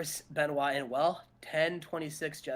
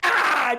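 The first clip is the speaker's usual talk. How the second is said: mock yell; 7 semitones higher, 7 dB louder than usual. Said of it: "And he was like".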